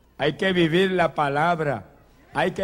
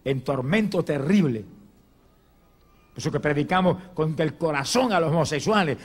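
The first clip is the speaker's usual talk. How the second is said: ordinary delivery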